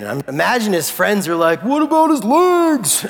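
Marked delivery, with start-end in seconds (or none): 1.6-3.0 high, drawn-out mock wail; protesting friend. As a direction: cry out like a baffled friend, high and stretched. Imagined friends protest to Jesus.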